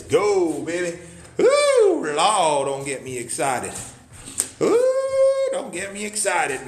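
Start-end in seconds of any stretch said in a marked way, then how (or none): 1.4-2.1 high, loud ooh squeal; excited squeal. Real, not acted. Host squealing as he gets himself excited, high loud ooh. 4.6-5.5 high, loud ooh squeal; excited squeal. Real, not acted. Host squealing as he gets himself excited, high loud ooh.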